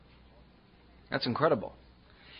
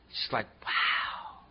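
breathless awe; 5 semitones lower, 7 dB quieter, slower than usual. Real, not acted. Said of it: "awe at nature".

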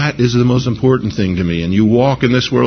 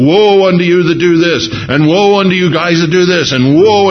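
louder, higher denouncing cry; thundering prophet; 7 semitones higher, 4 dB louder than usual. Retold or imitated. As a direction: rain down woes like a prophet. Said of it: "he was saying to the children of Israel".

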